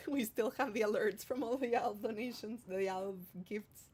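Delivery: ordinary talk, nothing marked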